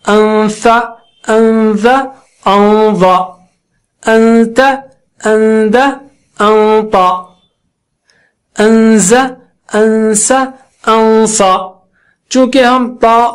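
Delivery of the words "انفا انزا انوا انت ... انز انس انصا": even, sustained nasal n before each letter; ghunna drill. Shown as a demonstration. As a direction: hold each n from انفا to انصا as a long nasal hum. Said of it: "اسی طرح".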